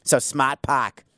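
slow Boston accent, "smart park" as "smaht pahk". Shown as a demonstration. Host trying his one Boston word, slow accent. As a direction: stretch "park" out Boston style. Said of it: "the only word I can say with Boston accent is park".

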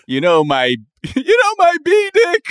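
laughing shout. Acted. Mock bee lament, laughing.

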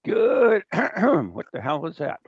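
ordinary talk

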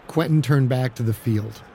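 usual speaking voice

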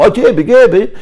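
high, loud imitation of a Moroccan; mimicking Moroccan dialect, high and loud; mock Maghrebi speech. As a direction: parrot the foreign-sounding words. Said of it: "وأنا أشوف إنه غير مفهوم".

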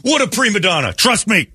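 high, loud hammy warning; mock warning. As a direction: camp it up as a warning. Warning others about Rock.

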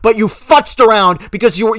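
ordinary talk

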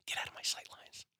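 voiceless hushed quote; whisper. Retold or imitated. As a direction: whisper it like a secret.

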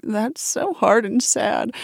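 a hint of mock heartbreak, slowed down; mock sad. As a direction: let it sink in with a touch of sadness. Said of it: "I imagine myself in it and I'm like".

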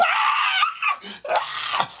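high, loud reenacted shriek; acted-out roller coaster scream; imitated terrified scream. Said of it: "I'm screaming at the top of my lungs".

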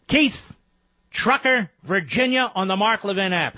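high-pitched announcer's call to the next caller. Real, not acted. Host calls up next caller.

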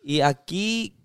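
usual speaking voice

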